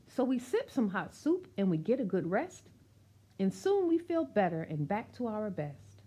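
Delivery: lively rhythmic read-aloud of a rhyming picture book; reading to children. Acted. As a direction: read it out warmly to a room of kids, bouncing the rhymes.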